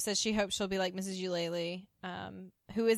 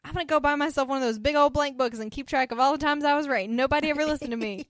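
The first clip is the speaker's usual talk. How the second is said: higher and louder, delighted rant; excited ranting; 6 semitones higher, 8 dB louder, faster than usual. Retold or imitated. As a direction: crow about being right, pitched up and loud. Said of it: "She is tickled pink".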